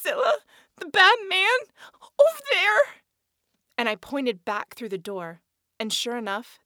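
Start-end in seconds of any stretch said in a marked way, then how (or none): 0.0-2.8 panicked crying cry. Acted. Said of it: "all I could manage was".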